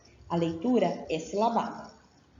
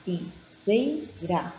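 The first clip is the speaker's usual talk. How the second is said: "lapiseira" slowly, with each syllable separated; much slower than usual. Shown as a demonstration. Teacher demonstrating reading by syllables, "lapiseira" slow and broken up. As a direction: sound out "lapiseira" one syllable at a time. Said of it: "a leitura é feita por sílabas".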